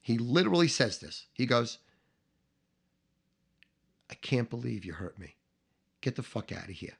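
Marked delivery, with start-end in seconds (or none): 4.1-7.0 low, soft, wounded voice; hurt. Retold or imitated. Boss dismisses the man who disappointed him, quietly hurt. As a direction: say it quietly, deeply let down. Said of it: "He was so hurt".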